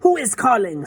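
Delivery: the witch bites off the question, irritated; snapped. A narrator's reading. Witch snaps at an unseen caller on her balcony. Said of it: "snapped the Grand High Witch".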